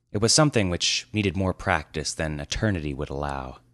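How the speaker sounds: plain reading voice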